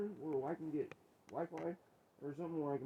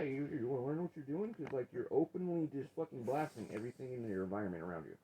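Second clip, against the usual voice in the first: usual speaking voice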